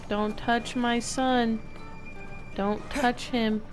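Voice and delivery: usual speaking voice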